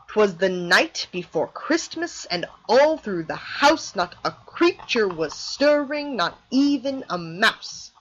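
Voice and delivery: slow, rolling da-da-DUM beat; anapestic recitation. Shown as a demonstration. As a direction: recite slowly, leaning on every third syllable. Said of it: "the anapest, that comes in three".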